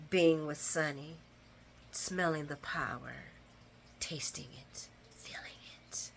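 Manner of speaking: retold taunt trailing into a whisper; hushed taunt. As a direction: fade into a lingering whisper, word by word. Acting out a seductive taunt, fading.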